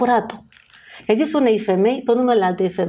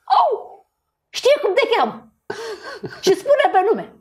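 much higher, amazed and laughing; mock surprised husband; 12 semitones higher than usual. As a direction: play the stunned husband, half laughing. Bărbatul surprins, râzând. (bărbatul uimit care află numele, vocea mult mai sus și cu râs)

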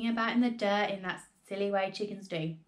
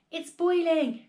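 much higher and louder, thrilled; excited cry; 8 semitones higher, 6 dB louder, slower than usual. A narrator's reading. Father watching the pan boil, crying out thrilled. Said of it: "cried Mister Cranky".

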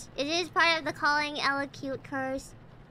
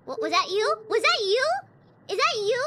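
shouting over machinery; 6 semitones higher than usual. Real, not acted. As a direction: call out over a noisy machine. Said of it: "I can't hear you over the machine".

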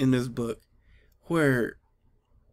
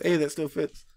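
normal talking voice

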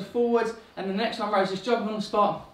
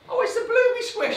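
much higher, sing-song pretend horror; mock dismay. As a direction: ham up the squashed blueberry for the toddler. Mock dismay at squashed berry.

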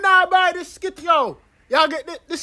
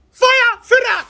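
scolding shout; 7 semitones higher, 3 dB louder than usual. Acted. Uncle scolds nephew on phone.

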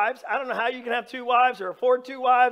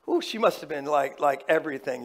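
lovestruck sigh; 9 semitones lower than usual. Retold or imitated. Jacob smitten with Rachel.

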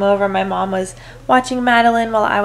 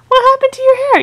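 much higher and louder, quicker; squeaky baby talk; 4 dB louder than usual. Acted. Mom teasing baby.

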